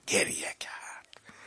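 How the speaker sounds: slow, very soft near-whisper; hushed grief. A narrator's reading. Preacher telling that the Prophet wept, hushed and slow. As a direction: let the voice drop away into a hush.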